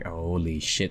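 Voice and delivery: low, hushed dismay; dismayed aside. Retold or imitated. Host recalls reading the script, quietly dismayed. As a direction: say it under your breath, sinking.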